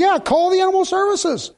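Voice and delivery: high mock agreement; sarcastic echo. Preacher sarcastically echoing the callers, high mock agreement. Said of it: "I'm saying".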